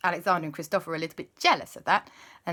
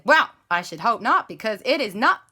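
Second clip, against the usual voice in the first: put-on accidental American accent; 4 semitones higher, 6 dB louder than usual. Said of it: "wait, I've gone American".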